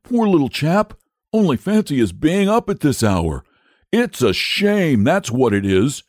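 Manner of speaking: acted indignant dragon, bustling; blustering, self-righteous dragon. A narrator's reading. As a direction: take charge as if it were your idea. Dragon blusters, turning the blame round.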